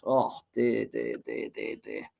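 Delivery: fading from a quiet sigh to a whisper; groaning in despair at a bad pass. Real, not acted. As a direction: dear dear dear dying off to a breath. Poor play, despairing sigh.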